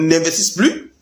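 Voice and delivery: ordinary talk, nothing marked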